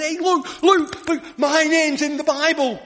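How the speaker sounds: put-on high, loud, eager boy's cry; a child shouting with excitement; excited child. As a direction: burst out like a thrilled little boy. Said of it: "he was really excited".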